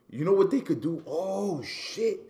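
high-pitched burst of sudden inspiration; light up as the idea hits you; real, high-pitched excitement at a brainwave; excited realization. Real, not acted. Sudden idea strikes him mid-discussion.

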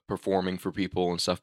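normal talking voice

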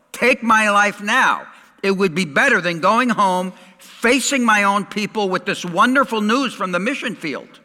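high, loud mock complaint to God; hammed-up despairing prayer; exasperated plea. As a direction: play the fed-up prophet. Acts Jonah's exasperated prayer.

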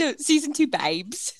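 slow drawled new accent. Shown as a demonstration. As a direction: stretch it out in a put-on accent. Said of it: "came back with a new accent".

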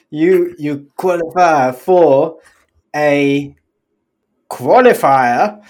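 a light mock German accent, slow. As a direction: drag qualifier out in hammed-up German. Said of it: "and then it was German".

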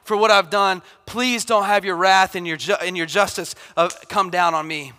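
begging God for forgiveness; pleading. Retold or imitated. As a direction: cry out for forgiveness, loud. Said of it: "He goes on, he's like".

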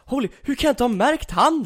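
high astonished exclamation; amazed. Retold or imitated. Coach realizing he missed a player, high and amazed. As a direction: sound floored that you never noticed him.

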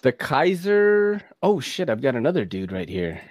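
ordinary talk, nothing marked

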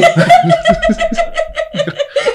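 high, bursting laugh. Real, not acted. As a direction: break into a big laugh. Laughs at wife's suggestion.

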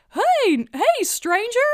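high, loud, sing-song greeting to a stranger; Clayton's greeting. Retold or imitated. Clayton hailing the barbecue family, high and loud. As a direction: call out brightly to a passer-by. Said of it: "And Clayton's like".

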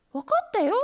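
high, loud kitten chorus. A narrator's reading. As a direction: shout it back at once. Kittens answering.

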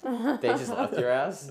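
high, laughing disbelief. Real, not acted. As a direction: laugh through the question. Laughing disbelief at friends fleeing.

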